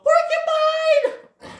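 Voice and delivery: very high, drawn-out shout; alarmed cry. Acted. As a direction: shriek the warning out to the gang. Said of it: "The guys go".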